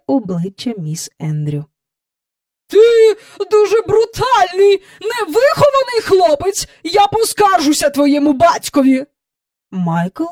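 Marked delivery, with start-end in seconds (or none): 2.7-9.1 high, loud outraged lady; indignant scolding. A narrator's reading. Visitor scolding the rude boy, indignant. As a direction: tell the child off sharply.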